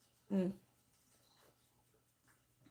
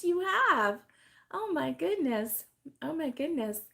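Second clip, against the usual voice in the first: ordinary talk, nothing marked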